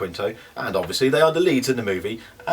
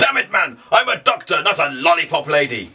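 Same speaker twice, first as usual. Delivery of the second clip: high, loud exasperated doctor outburst; ship's doctor; 6 semitones higher, 4 dB louder than usual. Retold or imitated. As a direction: snap it like a fed-up starship doctor. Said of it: "Karl Urban, who plays Bones".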